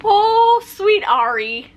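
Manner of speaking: loud "Oh", then a slow "sweet Ari"; mock tender pity. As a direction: coo at him as if hugging a sad puppy. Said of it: "take him and hug him and say".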